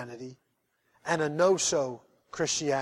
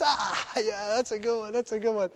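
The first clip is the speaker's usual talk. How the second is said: pretend amusement; 2 semitones higher, 6 dB quieter than usual. Driver pretends to get joke.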